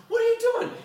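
very high, loud cry of disbelief; shocked high shout. Retold or imitated. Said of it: "He says".

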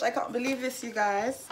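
ordinary talk, nothing marked

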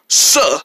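"sir" as a slow, low "suh"; affected pronunciation. Shown as a demonstration. Soki insisting on his new title, "sir" as a long "suh". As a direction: drop the r and stretch "sir". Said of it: "That's pronounced sir".

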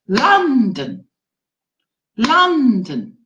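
"London" as big beat LON, small beat don; strong, lengthened LON in "London", weak falling ending; stress on first syllable. Shown as a demonstration. Teacher contrasting it with Japan, "London" stressed on LON. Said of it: "But London would be".

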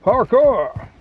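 high, loud triumphant "Parkour!"; excited shout. Real, not acted. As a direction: cheer "Parkour!" as you land the jump. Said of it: "You saw that?".

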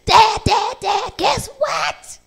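high, loud, drawn-out thrilled yell; a kid bursting in with big news; excited shout. Acted. Boy bursting into the kitchen to tell Dad, shouting excitedly.

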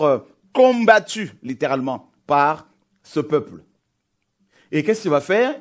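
loud, pressing preacher's call; fervent preaching. Acted. Preacher pressing the point home to the congregation, fervent.

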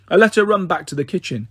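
ordinary delivery

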